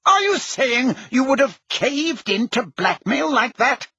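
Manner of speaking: high-pitched, affronted challenge; indignant. A narrator's reading. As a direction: throw the question back, offended. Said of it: "colouring up".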